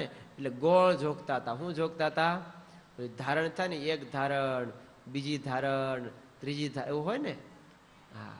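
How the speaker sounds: ordinary talk, nothing marked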